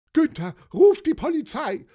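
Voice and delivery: loud, very high yell; bellowing. A narrator's reading. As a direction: shriek the order in alarm. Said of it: "brüllte Johanna Elisabeth".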